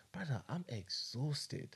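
soft, slow weary murmur; weary confiding. Retold or imitated. Recounts weary thought to himself.

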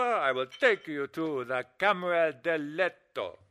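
slow, hammed-up fake Italian accent; he plays an Italian lover to seduce her with a phony accent; mock Italian lover. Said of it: "that phony Italian accent".

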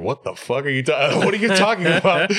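baffled. Retold or imitated. His baffled reaction.

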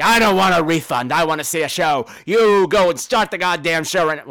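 retold furious patron; mock angry demand. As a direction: insist hard, loud and indignant. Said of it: "He's like".

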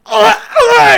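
high, loud strangled gasps; mock choking gasps. Kid with jump rope round his neck, loud mock choking. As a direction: choke out ah ah like a kid being strangled.

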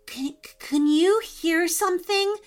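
high, stammered frightened question; scared. Acted. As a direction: stumble on the first word in fear. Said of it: "looking very scared".

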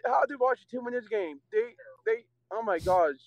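usual speaking voice

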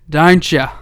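loud, punchy question to audience; loud appeal. Real, not acted. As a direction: call out to the listeners. Addressing audience loudly.

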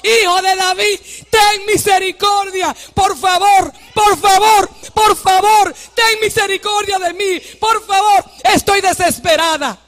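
high, forceful, repeated pleading; crying out. Shown as a demonstration. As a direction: cry out desperately for mercy. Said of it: "Yo se lo voy a gritar".

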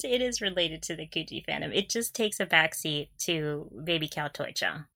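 ordinary talk, nothing marked

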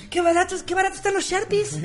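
high, excited bargain find; delighted. Retold or imitated. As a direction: gush over the cheap markers. Se emociona por plumones baratos. (emoción aguda por la ganga)

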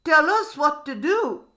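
mildly excited storybook crocodile. A narrator's reading. Crocodile eager for the king's orders, excited. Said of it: "said the crocodile excitedly".

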